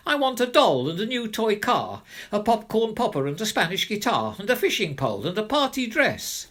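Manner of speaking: put-on high, loud greedy little girl rattling off wants; a spoiled kid firing off her wish list fast; demanding child.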